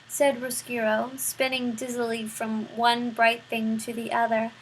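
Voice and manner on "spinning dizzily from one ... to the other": plain reading voice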